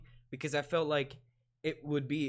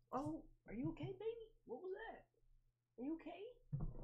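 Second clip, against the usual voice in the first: worried parent; 15 dB quieter than usual. Real, not acted. Talking to baby who sneezed.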